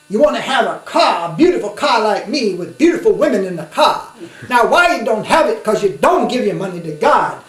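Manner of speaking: loud, high, laughing mock preacher sermon; flashy preacher. Mocking a flashy preacher urging the congregation to give, loud laughing imitation. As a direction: ham up a showy preacher begging for money, laughing. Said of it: "in his sermon he would say".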